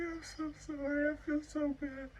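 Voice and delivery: high, tearful, pleading sorry; emotional apology. Retold or imitated. Apologizing to the nurse after soaking her hands, tearful plea. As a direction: plead for forgiveness, near tears. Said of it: "I was so emotional".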